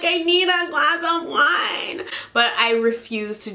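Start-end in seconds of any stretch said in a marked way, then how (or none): 0.0-2.1 mock wine craving. Craving wine while pregnant, mock plea.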